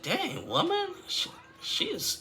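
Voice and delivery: squeaky falsetto gasp of disbelief, trailing to a breathy hush; shocked disbelief. Retold or imitated. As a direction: gasp it out high, then lose the voice. Own shocked reaction retold.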